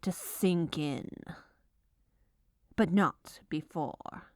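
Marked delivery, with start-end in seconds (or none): none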